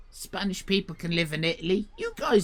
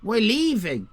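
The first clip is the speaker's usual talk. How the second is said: high, raised protest; 8 semitones higher, 3 dB louder than usual. Retold or imitated. Customer insisting they are leaving. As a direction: insist firmly. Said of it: "I said".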